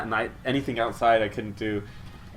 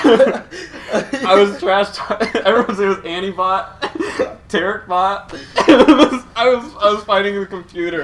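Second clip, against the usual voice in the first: high, loud, barely talking through laughter; laughing; 7 semitones higher, 6 dB louder than usual. Real, not acted. Confessing to friends he trash-talked bots, laughing. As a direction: crack up while getting the words out.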